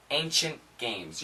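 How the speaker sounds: ancient games said quick, the T dropped. Shown as a demonstration. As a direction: run ancient games together with no T. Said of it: "you're not really gonna hear the T sound".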